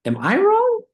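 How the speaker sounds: high squeaky disbelief; mock shock in a high voice. Friend retelling his shock at another pronunciation, high. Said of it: "I was like".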